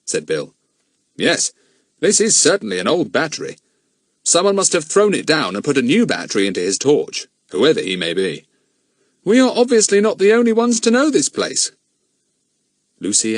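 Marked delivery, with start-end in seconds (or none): 1.2-11.7 a lightly voiced grown man, grave; steady adult man puzzling it out; a hint of a serious man; Bill's voice. Bill worried.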